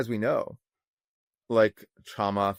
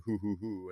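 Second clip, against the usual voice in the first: demon hooting; 9 dB quieter than usual. Retold or imitated. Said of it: "flies off into the night going".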